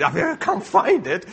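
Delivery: high, loud, drawn-out mock wail; mock weeping. Father imitating someone weeping over lost five euros, mock weeping. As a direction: wail it slowly with a laugh in it. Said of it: "they weep trying to find it".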